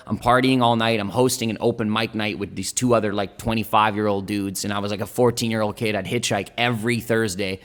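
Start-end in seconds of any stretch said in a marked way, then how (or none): none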